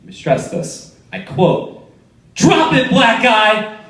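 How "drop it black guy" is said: high, forceful mock shout; shouted command. Gunman confronting a homeless man, hammed-up shouted command. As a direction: order him to drop it, higher pitched.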